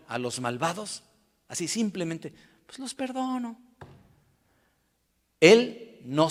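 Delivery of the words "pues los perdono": higher, softer offhand mock voice; mock easy pardon. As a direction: toss it off as a too-easy pardon. Imita un perdón demasiado fácil. (perdón fingido, ligero y agudo)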